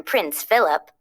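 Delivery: usual speaking voice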